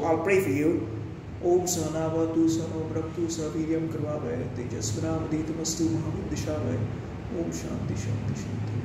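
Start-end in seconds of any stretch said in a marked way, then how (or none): 1.4-8.8 murmured prayer trailing into a hushed Om Shanti; Sanskrit prayer. Real, not acted. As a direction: recite a mantra before the meal, softening toward the end. Recites a prayer before eating.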